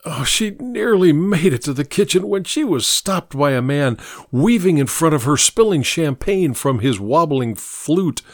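ordinary delivery, nothing marked

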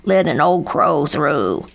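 put-on elderly crow voice; hollering old crow. A narrator's reading. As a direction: demand room as a grumbling old crow. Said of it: "hollered Grandfather Crow".

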